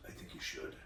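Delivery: breathy whisper; a hushed, menacing reply; whispered threat. Retold or imitated. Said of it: "And he goes".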